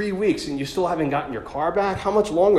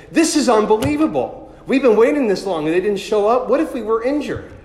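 loud, high, exasperated complaining; upset complaint; 8 semitones higher, 8 dB louder than usual. Retold or imitated. Other driver complaining to the speaker about the late police, loud and exasperated. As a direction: retell the other driver's outburst in full. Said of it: "is getting upset".

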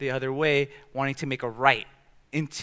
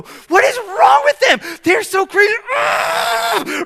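much higher, louder hammed-up angry shout; road rage yell; 11 dB louder, slower than usual. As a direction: yell at the other drivers in the car.

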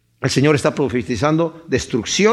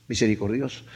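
soft echo; 7 semitones lower, 8 dB quieter than usual. Shown as a demonstration.

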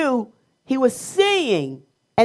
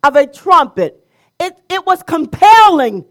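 higher, louder sermon voice with punched adjectives; forceful preaching; 5 semitones higher, 6 dB louder than usual. Real, not acted. Preacher describing God's trumpet-blast voice to worshipers, forceful preaching. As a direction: hammer each describing word to the congregation.

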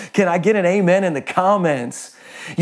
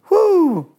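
a sudden high, louder woo of delight; excited whoop; 13 semitones higher, 3 dB louder than usual. Real, not acted. Preacher whoops over his own line, excited holler. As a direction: let out a joyful holler at the punchline.

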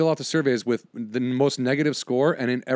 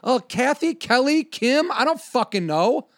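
pitched way up, fumbling through names in frustration; exasperated guessing; 9 semitones higher than usual. Real, not acted. Frustrated customer failing to recall the clerk's name, exasperated. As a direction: throw out names, higher and more annoyed each time.

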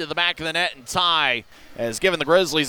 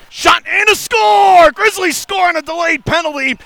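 high, loud, stretched goal call; goal call shout; 13 semitones higher, 8 dB louder than usual. Real, not acted. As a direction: yell the score to the crowd. Goal call in hockey broadcast.

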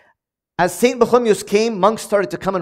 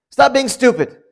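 higher, louder, curt put-down; blunt put-down; 6 semitones higher, 5 dB louder than usual. Retold or imitated. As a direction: snap it at a foolish brother. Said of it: "that's how he would say it to his brother".

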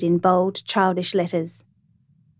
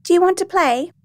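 put-on very high, eager child's voice; little girl; 10 semitones higher, 3 dB louder than usual. A narrator's reading. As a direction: ask like a child wanting a playmate. Little girl invites narrator to play.

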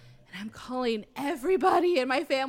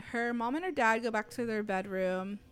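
normal talking voice

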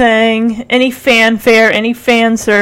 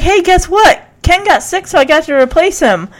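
excited; 6 semitones higher, faster than usual. Retold or imitated. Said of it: "Rick, of course, is like".

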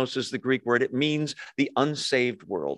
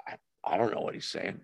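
quick, much softer private mumble; confused inner mutter; 7 semitones lower, 10 dB quieter, faster than usual. Retold or imitated. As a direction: mumble it low and fast to yourself. Students secretly confused in class.

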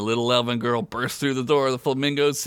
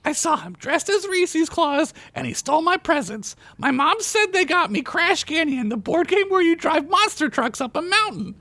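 put-on high child voice, insistently recounting; defiant little girl. A narrator's reading. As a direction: protest her case like a small girl. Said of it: "Tina said defiantly".

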